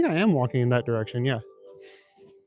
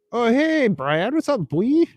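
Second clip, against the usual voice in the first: much higher, goofy bro greeting; squeaky put-on dude voice; 10 semitones higher, slower than usual. Streamer greeting a chatter, squeaky goofy put-on voice. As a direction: pitch it way up like a cartoon buddy.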